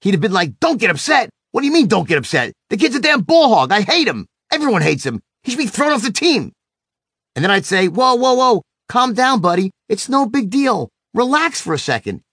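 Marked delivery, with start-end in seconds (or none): 0.6-6.5 put-on high-pitched, indignant kid outburst; imitated mad son. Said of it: "he would have gotten even madder". 7.9-12.2 high-pitched pleading to settle down; placating dad. Retold or imitated. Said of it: "like I was trying to calm him down".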